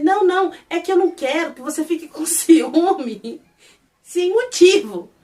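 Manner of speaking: acted defensive excuse; flustered protest. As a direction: plead your way out of it. Acted excuse in lovers' quarrel.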